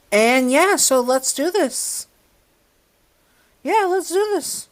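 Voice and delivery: high, bright hype; excited. Real, not acted. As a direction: pump it up, way up high. Hyped intro to video.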